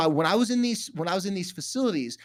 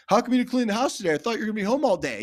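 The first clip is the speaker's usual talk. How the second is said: accusing nag; 5 semitones higher, 4 dB louder, faster than usual. Retold or imitated. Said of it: "spouse comes home and says".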